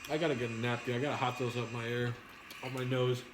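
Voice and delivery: ordinary delivery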